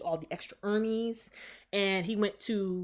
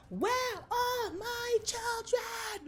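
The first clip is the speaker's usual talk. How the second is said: high, slow wailing cry; hollering; much slower than usual. Retold or imitated. Wanda hollering for her children, slow high wail. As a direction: drag it out like a grieving witch. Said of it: "this bitch was just hollering".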